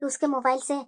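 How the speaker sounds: softly muttering mother-in-law; muttering to herself. Acted. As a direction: grumble under your breath.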